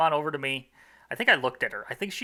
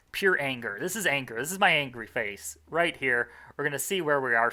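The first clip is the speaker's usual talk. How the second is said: normal talking voice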